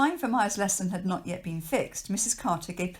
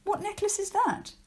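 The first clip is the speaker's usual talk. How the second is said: much higher, surprised question; 14 semitones higher than usual. Acted. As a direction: ask in sudden surprise. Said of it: "asked Maya, surprised".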